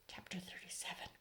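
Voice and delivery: breathy hush on the chapter title; whispered chapter heading. A narrator's reading. Narrator whispering the chapter number to listeners.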